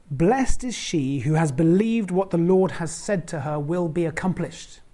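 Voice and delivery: slow, measured reading of the verse; scripture reading. Acted. Preacher reading Elizabeth's blessing slowly. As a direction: read the verse with weight. Quoting verse forty-five.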